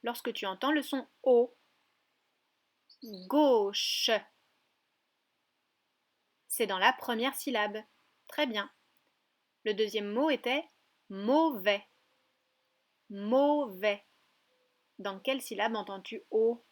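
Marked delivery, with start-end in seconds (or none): 3.3-4.1 "Gauche" stretched with a long O; syllable by syllable. Shown as a demonstration. Teacher repeats a word to find the O, drawn out. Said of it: "la syllabe". 11.1-14.0 "mauvais" in two stretched syllables. Shown as a demonstration. Teacher repeats the second word for a child, syllable by syllable. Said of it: "la syllabe".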